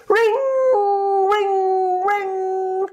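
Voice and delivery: high ringing alarm imitation; fire bell ringing. A narrator's reading. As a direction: ring it like a school bell.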